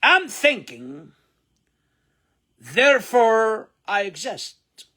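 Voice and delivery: I'm thinking, therefore I exist, very slow and high; slow model phrase. Shown as a demonstration. Teacher models the phrase for learners. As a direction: drill it word by word. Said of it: "ونقف شوية عند verb think".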